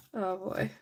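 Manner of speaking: ordinary talk, nothing marked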